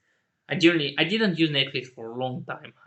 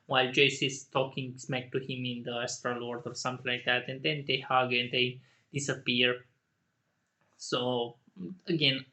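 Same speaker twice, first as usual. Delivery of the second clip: ordinary talk, nothing marked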